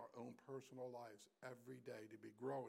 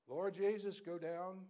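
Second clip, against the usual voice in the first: loud, raised call from on high; booming command of God; 7 semitones higher, 11 dB louder, slower than usual. Acted. Preacher voicing God sending his Son for his children, calling out loudly. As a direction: cry it out as God's command. God's command repeated, called out.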